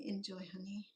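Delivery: soft, whispered aside to a sweetheart; imitated quiet murmur to partner. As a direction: say it under the breath, nearly voiceless. Quoting words to a partner at table.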